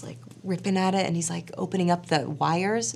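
ordinary talk, nothing marked

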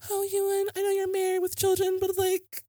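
very high, whiny pleading falsetto; lovesick teen. Retold or imitated. Lovesick teen begging a married idol, hammed-up pleading.

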